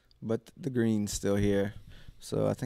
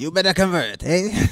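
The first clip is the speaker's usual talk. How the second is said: mock threatening voice; 8 semitones higher, 8 dB louder than usual. Joining the mock terrorist bit.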